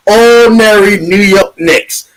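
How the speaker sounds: ordinary delivery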